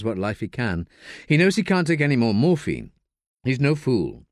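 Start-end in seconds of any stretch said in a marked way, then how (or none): none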